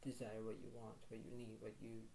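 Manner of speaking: ordinary talk, nothing marked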